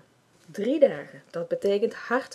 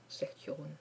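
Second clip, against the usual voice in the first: plain reading voice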